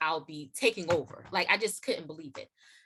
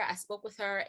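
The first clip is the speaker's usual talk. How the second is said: ordinary delivery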